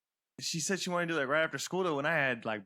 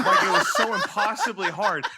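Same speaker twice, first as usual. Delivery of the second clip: laughing hard through the words, louder; words pushed out between laughs, louder; 5 semitones higher, 9 dB louder than usual. Real, not acted. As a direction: crack up while telling it. Laughing while telling.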